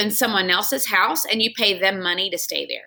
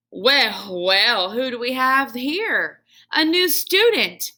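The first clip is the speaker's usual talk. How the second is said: big booming greeting, louder; 4 semitones higher, 4 dB louder, slower than usual. Acted. Janitor greeting the new class pet, booming. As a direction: boom it out like a jolly giant. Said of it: "a voice boomed".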